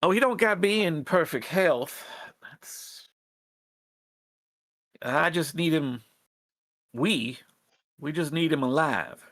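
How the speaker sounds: lightly voiced rat plotter in folksy dialect; folksy character voice, halting appeal; earnest rebel rat leader. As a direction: hesitantly plead for the tribe's support. Rebel leader answers the players.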